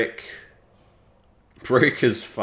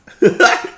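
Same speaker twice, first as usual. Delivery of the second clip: breathy relieved laugh; 13 semitones higher, 12 dB louder than usual. Real, not acted. Relief after the scare.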